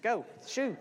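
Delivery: high, hammed-up shooing yell with a laugh; shooing vultures. Preacher pictures Abraham driving off vultures, high mock shooing. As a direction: chase the birds off, playfully. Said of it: "I can just picture Abraham".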